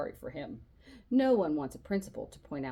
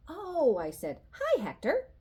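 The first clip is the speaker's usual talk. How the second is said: high, louder oh of recognition; bright realization; 10 semitones higher, 5 dB louder, slower than usual. A narrator's reading. Charlie reads the new boy's name, high bright hello. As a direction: get it, then greet warmly.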